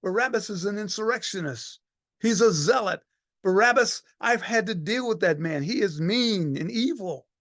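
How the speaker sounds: high, urgent pleading; the centurion protests to the crowd: high pleading. Acted. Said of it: "I'm going".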